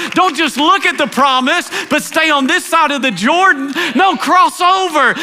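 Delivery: climbing fervent sermon to a shouted peak; preaching at full force. Acted. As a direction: preach it hard, rising to the cry. Preacher builds to a climax.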